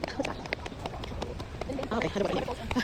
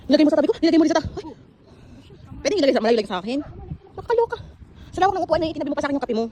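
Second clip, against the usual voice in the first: sassy complaint; 5 semitones higher, 5 dB louder, much faster than usual. Real, not acted. Complaining coffee placed beside her.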